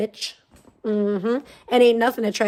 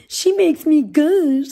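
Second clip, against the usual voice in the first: higher, louder, slow lovestruck drawl; mock swoon; 6 semitones higher, 6 dB louder, slower than usual. Streamer parodying a fan's crush, slow swooning voice. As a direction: drag out makes like a smitten fan. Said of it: "mocking Sandman's line again".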